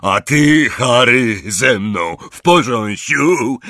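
big, loud voice with slight worry; booming, drawn-out concerned question; Hagrid. A narrator's reading. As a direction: ask slowly and loudly, a touch uneasy. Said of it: "zapytał Hagrid z lekko zaniepokojoną miną".

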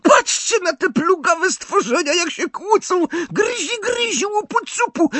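very high, gleeful poltergeist taunting; spiteful cackling glee. A narrator's reading. Poltergeist mocking the brawling house-elves, spiteful. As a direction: jeer at the fighting elves with malice. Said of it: "zachichotał złośliwie".

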